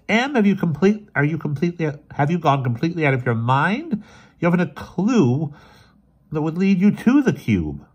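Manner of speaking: high, loud, appalled protest; aghast. A narrator's reading. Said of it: "Miss Eels was aghast".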